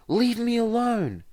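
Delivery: high, loud desperate yell. Acted. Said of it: "Izuku yells through his door".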